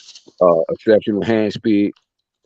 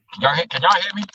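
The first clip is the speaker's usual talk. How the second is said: far from phone; 8 semitones higher, faster than usual. Real, not acted. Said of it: "it sounds like you're far away from the phone".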